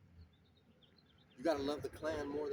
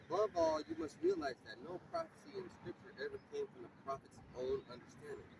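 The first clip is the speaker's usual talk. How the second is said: slow, raised reading voice, solemn; scripture reading; 11 semitones higher than usual. Acted. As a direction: read the verse out to the brothers like a sermon. Reads scripture aloud.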